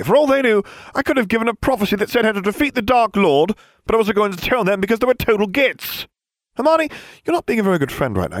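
put-on high, fast whiny boy rant; cocky teen boy. Harry's voice ranting.

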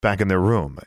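plain reading voice

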